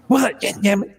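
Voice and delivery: high, curt imitated retort; pissy. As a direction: snap back as a mock-annoyed passenger. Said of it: "he's just so pissy now".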